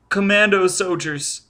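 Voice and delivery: put-on high little-boy voice; child answering. A narrator's reading. Child explains to Foyle which soldiers, high voice. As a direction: announce it as a small child. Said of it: "the child answered".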